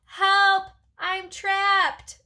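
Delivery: high, loud cry for help; squeaked yell. A narrator's reading. Hamster trapped under a bag, squeaking for help. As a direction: yell out high, stretching the words. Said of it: "I squeaked".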